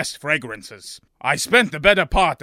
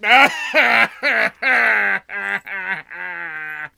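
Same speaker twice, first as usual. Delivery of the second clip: hammed-up wailing sob; 4 semitones higher than usual. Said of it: "I wept uncontrollably for some time".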